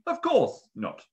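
nervous high-pitched answer; high, shaky. A narrator's reading. Ebenezer answering the suspicious bird keeper, high and shaky. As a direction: jump up high, voice unsteady. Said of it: "in a high and shaky voice".

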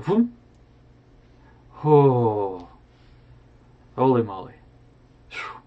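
plain reading voice

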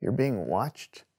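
ominous. Acted. Spooky cold open.

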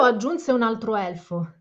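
ordinary delivery, nothing marked

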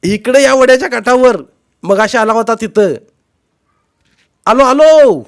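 high, full-throated holler; shouting across distance. A narrator's reading. Two relatives locating each other by the stream, shouting. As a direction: call it out to carry far.

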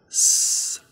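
"S" as a voiceless hiss, held alone; clear S sound. Shown as a demonstration. Teacher modelling the letter S, "S" as a clear hissed sound. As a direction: say "S" as just the unvoiced sss sound. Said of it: "pronounced as a clear S".

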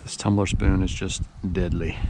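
ordinary delivery

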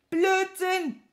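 high, loud explosive shout; furious yell. A narrator's reading. Wizard enraged by his servant's muddle, yelling. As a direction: explode at the nonsense. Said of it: "schrie Petrosilius Zwackelmann".

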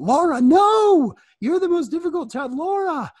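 high, loud squeal of disbelief; comically stunned, voice shooting way up; mock shock. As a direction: cry out as if scandalized. Mock shock at a raised hand.

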